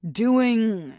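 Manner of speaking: high, loud clipped question; annoyed. A narrator's reading. Jarrett demanding what kept her busy, annoyed. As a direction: press her, irritated. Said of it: "He's annoyed".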